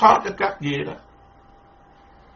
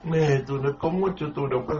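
softer, grumbling under the breath; muttered complaint; 2 semitones lower, 8 dB quieter than usual. Acted. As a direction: mutter it like a sulky monk. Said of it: "Nói".

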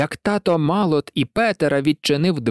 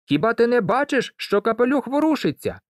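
high-pitched call, only mildly raised volume; 8 semitones higher than usual. A narrator's reading. Peter alerts the girl to the moving hat, calling high. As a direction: call it out high, like an urgent boy. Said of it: "гукнув Петер".

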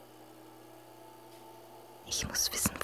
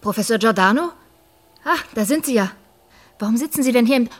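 loud, higher relieved calls; calling out; 4 semitones higher, 8 dB louder, faster than usual. Acted. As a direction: spot him and call over. Calling, then finding him.

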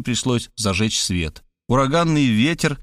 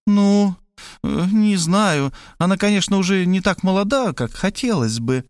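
hesitant mother; 7 semitones higher than usual. A narrator's reading. Mother dodging the bride's age, high and hesitant. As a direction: stall like a caught-out mother.

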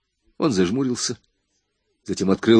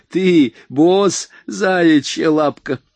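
higher, louder mocking jab, slow at the end; 8 semitones higher, 5 dB louder, much slower than usual. A narrator's reading. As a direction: belittle him with a sneer. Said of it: "иронически кривя губы".

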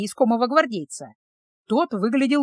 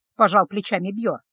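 usual speaking voice

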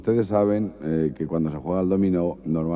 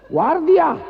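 chillido muy agudo y fuerte; llama al guardia a voces; grito al guardia; 8 dB louder than usual. Acted. (grito agudísimo al guardia)

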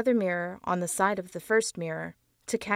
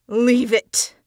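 loud shouted "Leave it!", higher and louder; scream; 4 semitones higher, 9 dB louder than usual. Acted. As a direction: yell it at the face to stop. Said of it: "screamed Franz".

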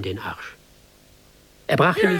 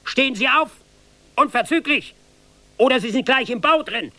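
angry officer; 9 semitones higher than usual. Acted. Officer raging at the seated recruit, shouting. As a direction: rage at the insolent recruit. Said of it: "schrie er mich an".

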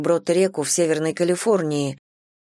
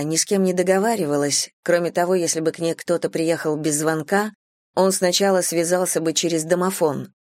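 ordinary delivery

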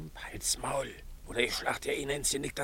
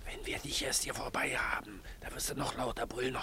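hissed menace, no voice; threatening whisper; 2 semitones higher than usual. Acted. Crook threatens the captive vicar, whispered. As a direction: whisper the threat close to his ear.

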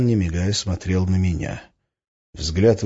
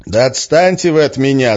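loud, higher-pitched protest; shouting; 5 semitones higher, 6 dB louder than usual. A narrator's reading. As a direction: shout them away from you. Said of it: "закричал я". (раздражённый крик)

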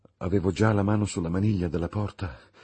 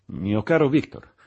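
higher, louder alarmed call; exclaiming; 5 semitones higher, 6 dB louder than usual. Acted. Clerval exclaiming at his friend, higher and louder. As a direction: cry out to a troubled friend. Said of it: "esclamò".

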